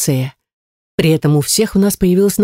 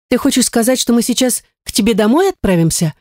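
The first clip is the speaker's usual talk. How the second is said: raised-pitch hopeful asking; hopeful question; 6 semitones higher than usual. A narrator's reading. Lira asking about going to her friend's home, hopeful. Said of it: "с надеждой в глазах спросила Лира".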